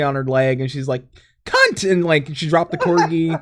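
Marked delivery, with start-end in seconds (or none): 1.5-1.9 very high mock royal outburst; the Queen. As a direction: squeal it in falsetto as a comic royal. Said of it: "I'm pretty sure the Queen's".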